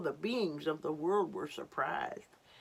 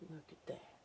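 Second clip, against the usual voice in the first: hushed aside; 14 dB quieter, faster than usual. Real, not acted. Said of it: "reading to children hushed".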